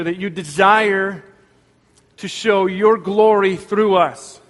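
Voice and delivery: earnest pastoral prayer, rising in intensity; fervent prayer. Acted. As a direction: pray it aloud for the congregation, building in earnest. Pastor leads closing prayer.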